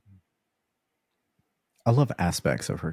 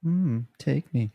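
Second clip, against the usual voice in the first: higher, slow, mock seductive purr; play sexy; 8 semitones higher, 3 dB louder, slower than usual. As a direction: ham up a teasing come-on, slowly. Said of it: "kinda like play sexy".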